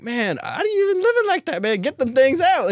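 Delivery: high, incredulous voice; mock outraged friend. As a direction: act out his disbelief. Telling celly to remove implants.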